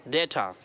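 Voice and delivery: Data as DAY-ta, dropping low; stress on डे. Shown as a demonstration. Teacher showing the stressed syllable, DAY-ta. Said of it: "stress करेंगे डे पर".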